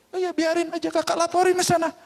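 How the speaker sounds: high, fast, carefree brush-off; cheeky child not caring; defiant. Acted. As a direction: dismiss the threat breezily. Boy shrugs off threat.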